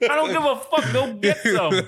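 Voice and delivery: laughing through the words; cracking up while egging them on. Real, not acted. Cohost laughing along at the kitchen chaos.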